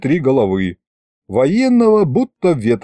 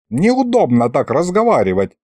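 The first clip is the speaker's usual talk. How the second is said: ordinary delivery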